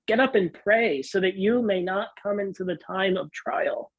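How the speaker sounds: usual speaking voice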